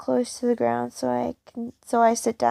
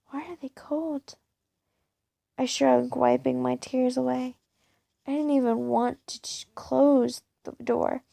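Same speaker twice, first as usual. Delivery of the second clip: ordinary delivery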